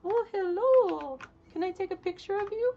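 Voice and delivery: cooing at the in-game cats, high and tender; cutesy voice for cats. Real, not acted. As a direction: coo at little cats like a pet owner.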